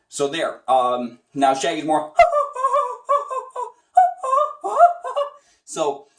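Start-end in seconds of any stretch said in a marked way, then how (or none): 2.2-3.7 much higher falsetto giggle; real laugh up high; high squeaky laugh. Real, not acted. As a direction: cracks up in the high voice. Laughing at own impression.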